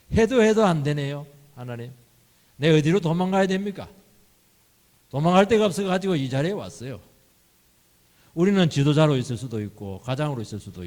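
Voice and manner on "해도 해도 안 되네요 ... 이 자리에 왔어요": desperate plea. Acted. Preacher voices desperate prayer.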